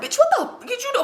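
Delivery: high-pitched mock scolding; getting at her. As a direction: lay into her, retelling his outrage. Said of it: "he getting at her like".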